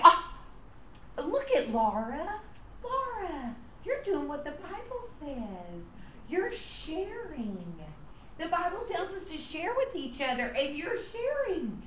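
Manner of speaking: high, gushing teacher praise, slowed. Shown as a demonstration. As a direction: pour on the delighted praise. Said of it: "then you say".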